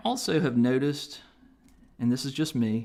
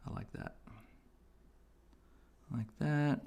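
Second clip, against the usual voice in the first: holding breath; 2 semitones higher, 7 dB quieter than usual. Real, not acted. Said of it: "I stop talking when I'm holding my breath".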